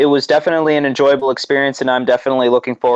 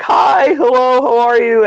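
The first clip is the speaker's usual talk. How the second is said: excited greeting; 11 semitones higher than usual. Retold or imitated. Fan greeting his favorite wrestler, high excited squeal. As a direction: gush hello like a starstruck fan. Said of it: "I was like".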